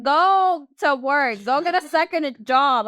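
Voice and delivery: loud, slow, drawn-out order; exasperated scolding. Retold or imitated. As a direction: slowly order him to go to work. Scolding a broke boyfriend.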